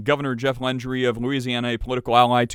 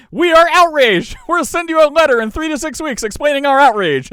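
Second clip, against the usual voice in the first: high, loud, hammed-up indignation; mock outrage; 13 semitones higher, 6 dB louder than usual. Host mocks weak Danish officials' protest, hammed-up outrage. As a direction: declaim it as a toothless official in a huff. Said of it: "express outrage".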